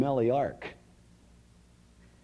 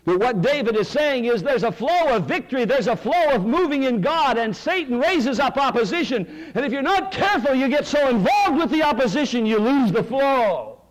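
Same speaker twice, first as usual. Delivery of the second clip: higher, louder, driving sermon voice; full-force preaching; 13 semitones higher, 8 dB louder than usual. Acted. Pastor preaching to the congregation, full force.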